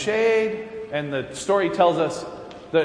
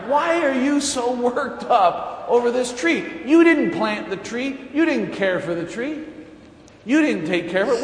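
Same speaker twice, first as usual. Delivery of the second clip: lightly voiced chiding God; 8 semitones higher than usual. God chiding Jonah over tree.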